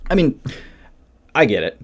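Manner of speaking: usual speaking voice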